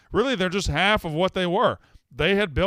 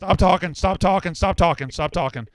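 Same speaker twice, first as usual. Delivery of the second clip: loud, higher repeated command; exasperated shouting; 4 semitones higher, 6 dB louder than usual. Real, not acted. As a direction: talk over the caller forcefully. Host cuts off arguing caller.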